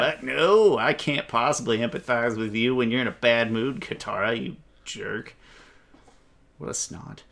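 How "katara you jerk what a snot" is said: muttered insult. Real, not acted. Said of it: "annoyed at Katara".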